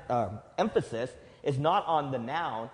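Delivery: ordinary delivery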